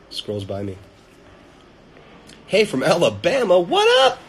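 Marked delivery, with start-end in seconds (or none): 2.5-4.2 loud, high-pitched holler hello; whooping, high shouted hello; excited shouted greeting. Real, not acted. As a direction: shout out to them, climbing much higher at the end. Calling out to viewer from Alabama.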